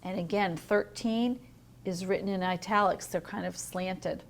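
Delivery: plain reading voice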